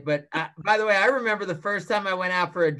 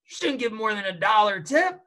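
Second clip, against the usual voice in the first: higher, scolding with a laugh; indignant boss; 10 semitones higher than usual. Retold or imitated. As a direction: scold him for over-tipping, half laughing. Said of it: "He's like".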